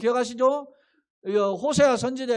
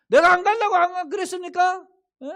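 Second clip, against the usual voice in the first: higher, louder, the first 안 punched hard; Jonah's indignant retort; 10 semitones higher, 7 dB louder than usual. Retold or imitated. Jonah challenging God, didn't I say so, punching 안. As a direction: snap back, hitting 안 in 안 간다고.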